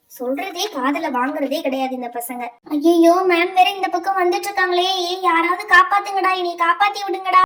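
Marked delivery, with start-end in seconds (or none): 2.7-7.4 louder, urgent plea for help; panicked student. Acted. Student begging friends as teacher walks over, panicked. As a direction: beg your friends to save you.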